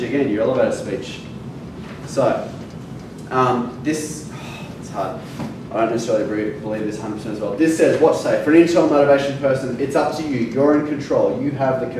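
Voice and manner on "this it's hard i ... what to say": usual speaking voice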